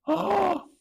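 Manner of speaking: sharp high gasp of surprise; mock gasp. Teacher gasping at a student's answer, mock shock.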